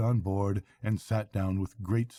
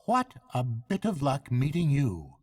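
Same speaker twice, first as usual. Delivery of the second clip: higher, musing; thoughtful barge woman; 8 semitones higher, 4 dB louder than usual. A narrator's reading. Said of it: "observed the barge woman thoughtfully".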